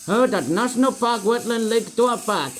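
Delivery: badly faked Indian accent; mock Indian accent. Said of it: "I can speak Indian now".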